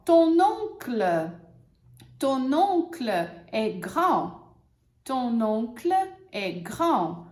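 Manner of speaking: slow, clear example; teacher models the sentence. Shown as a demonstration. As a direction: model it slowly. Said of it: "só pra gente treinar".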